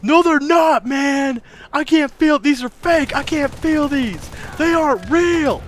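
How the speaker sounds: high, loud panicked protest; screaming. Retold or imitated. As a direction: hysterical retold scream, slowing on the last lines. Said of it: "And starts screaming".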